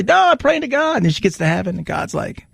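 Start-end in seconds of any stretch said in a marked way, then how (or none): 0.0-1.0 much higher, mock-stubborn no; hammed-up Karen refusing again; stubborn refusal. Karen refusing the helicopter, higher and mock-stubborn.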